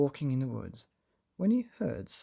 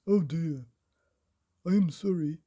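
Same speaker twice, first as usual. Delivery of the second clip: louder mock-sorry old carpenter; old Mister Chips; 2 semitones higher, 6 dB louder than usual. A narrator's reading. Old Mister Chips to Mister Nosey, louder mock apology. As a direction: fake apology, hammed-up. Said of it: "said old Mister Chips".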